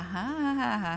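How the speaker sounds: ordinary talk, nothing marked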